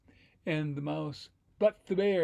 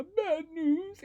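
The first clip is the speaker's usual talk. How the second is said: high, loud mock wail; hammed-up weepy bunny; crying rabbit; 13 semitones higher, 6 dB louder than usual. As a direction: bawl the words tearfully. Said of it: "the rabbit cries".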